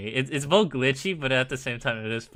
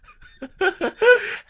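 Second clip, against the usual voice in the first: laugh; 9 dB louder than usual. Real, not acted. Laughs at own story.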